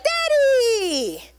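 put-on high, drawn-out child's call; excited little child. Little child running freely to their dad, excited call. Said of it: "run freely to their dad or their parent and be like".

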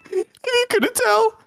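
very high cartoon voice; Shaggy. Streamer explaining the impression to viewers, high cartoon voice. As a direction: stay high in the cartoon character. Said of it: "I'm Shaggy!".

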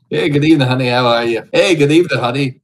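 loud, high leering lounge-lizard greeting; sleazy greeter. Acted. Streamer greets Omegle strangers as a leering creep, loud and high. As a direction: belt it out like a creepy host hitting on strangers.

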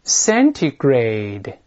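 "centigrade" said very slowly with T fully pronounced; slow model reading. Shown as a demonstration. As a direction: drag "centigrade" out for students to copy. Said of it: "再来一遍". (再慢读一遍"centigrade"，每个音都发清楚)

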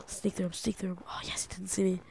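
whisper. Real, not acted. Player sneaking past a creeper, whispering. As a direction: hush as if the creeper could hear.

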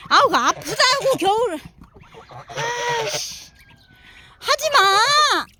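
high, loud irritated complaint; annoyed pain. Real, not acted. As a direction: tell the biting duck to stop. Ducks keep biting him. (물려서 짜증내며)

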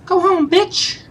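a high cartoon jeer; squeaky mock taunt.

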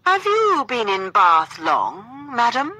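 high, loud, drawn-out mock-courtly question; exaggerated politeness. A narrator's reading. Gentleman playfully questioning his dance partner, exaggeratedly polite. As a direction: lay on extreme politeness, slowly and loudly. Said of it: "in an exaggerated, extremely polite voice".

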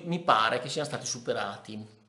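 ordinary talk, nothing marked

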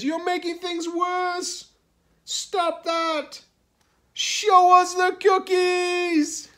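high, loud, slowing demands; exasperated shout. A narrator's reading. Crocodile orders Hippo to stop, exasperated shout. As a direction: scold at the top of your voice.

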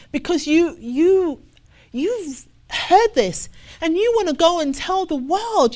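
ordinary delivery

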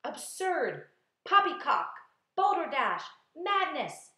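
high, loud, scornful exclamations; shouted. A narrator's reading. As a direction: hurl each word out separately. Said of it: "they shouted".

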